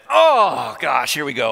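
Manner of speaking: high, loud groaned "Oh" that drops away; exasperated. Retold or imitated. Pastor seeing the garage door wide open, exasperated. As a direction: groan in mock dismay, then give in.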